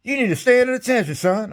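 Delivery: a hammed-up bark of command; the general to his soldier, mock stern and high; imitating the general. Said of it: "He said".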